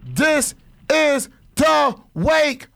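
slow, loud wake-up shout; hype shout. Acted. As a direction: yell it out slowly to wake everyone.

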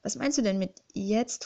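usual speaking voice

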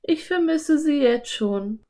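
put-on high girl character voice; Anne. A narrator's reading. Anne tells Philipp she misses the dolphins, high character voice.

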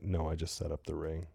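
retold low-key brush-off; modest denial. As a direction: play it down under your breath. Retelling own modest denial.

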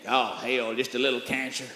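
put-on low, soft old man shrug; unbothered grandpa.

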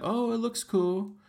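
high, slow gullible "Oh, it looks cool."; mock naive investor. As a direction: play the easily impressed newbie. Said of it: "They're gonna be like".

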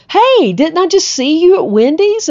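high, loud, eager hello; friendly excited greeting. Retold or imitated. Guy greets woman he saw at Wendy's, excitedly. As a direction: call out in delighted recognition.

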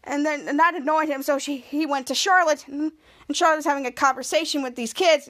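ordinary talk

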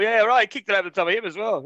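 scoffing, high and quick; mock the tall story; sarcastic. Real, not acted.

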